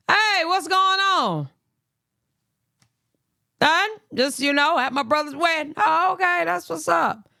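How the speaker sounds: awkward greeting. Retold or imitated. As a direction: sound politely awkward with her.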